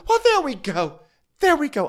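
high, excited there we go; triumphant. Real, not acted. Host catching the admission, high excited. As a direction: pounce with delight. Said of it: "host reacting".

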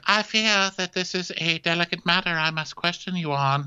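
high, measured royal address; mock formal queen. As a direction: lightly play a queen raising a delicate matter. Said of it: "she's like".

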